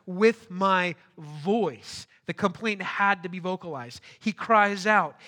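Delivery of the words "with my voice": "With my voice" stretched very slowly and louder; slow it right down, lean on "With my voice"; stress on voice. Shown as a demonstration. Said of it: "He says twice".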